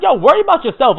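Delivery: mock cocky retort; imitating Iguro's jab. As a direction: brush the kid off with a cocky jab. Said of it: "Iguro was like".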